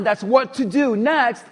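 usual speaking voice

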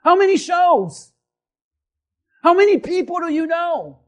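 forceful rhetorical challenge; full-force preaching. Acted. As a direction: drive the question home at the crowd. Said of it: "preaching at full force".

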